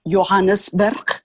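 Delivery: Johannesburg in Afrikaans, starting with a Y sound; Afrikaans-accented Johannesburg, open AH in the second syllable; Afrikaans pronunciation. Shown as a demonstration. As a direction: say Johannesburg as Yo-HAH-nis-berg, in Afrikaans. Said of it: "or in Afrikaans".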